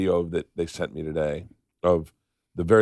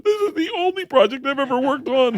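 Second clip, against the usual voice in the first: put-on much higher childlike deadpan; little girl voice. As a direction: mimic an eleven year old's earnest reply. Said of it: "She goes".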